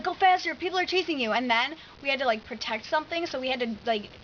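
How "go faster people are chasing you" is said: high, louder urging on the riders; urgent retold command. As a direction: imitate a hypnotist spurring riders on.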